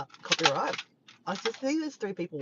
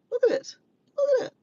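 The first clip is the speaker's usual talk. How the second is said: excited; 9 dB louder, faster than usual. Real, not acted. Showing the grey sky.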